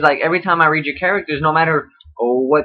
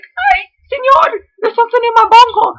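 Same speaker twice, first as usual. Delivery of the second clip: silly falsetto shout; 4 dB louder than usual. Pretend. Reviewer hams up Jeff's line in a shrieking cartoon voice.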